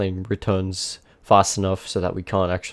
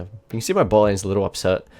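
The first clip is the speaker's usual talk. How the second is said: ordinary talk, nothing marked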